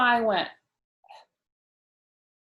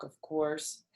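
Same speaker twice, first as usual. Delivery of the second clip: usual speaking voice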